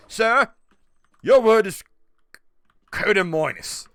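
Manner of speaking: a light imitation of an English actor; Michael Caine. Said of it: "If Michael Caine walked up, he's like".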